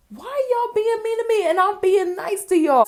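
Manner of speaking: high, plaintive mock complaint; whiny retold appeal; hurt protest. Retold protest at mean people.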